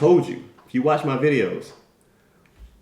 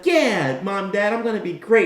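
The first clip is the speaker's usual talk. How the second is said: put-on eager kid; 6 semitones higher than usual. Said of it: "little Timmy's like".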